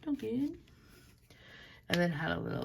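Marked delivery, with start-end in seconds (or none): 0.0-0.6 Dunkin' said again higher with a lilting rise; playful high repeat. Shown as a demonstration. Vlogger echoing the coffee shop name, high playful lilt. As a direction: sing Dunkin' back up high and bouncy.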